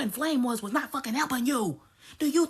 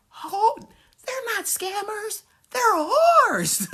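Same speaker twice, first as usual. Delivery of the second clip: higher, much louder outburst; shouted; 7 semitones higher, 6 dB louder, much slower than usual. Real, not acted. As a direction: blurt it out in a shout. Ranting outburst.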